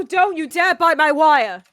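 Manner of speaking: loud, slow warning to the cat; scolding the cat. Real, not acted. Scolding cat biting wire.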